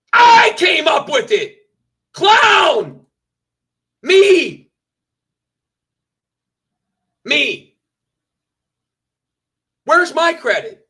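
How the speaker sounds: loud, high-pitched indignant shouting; indignant outburst. Real, not acted. Streamer demands credit from his crew, indignant shouting.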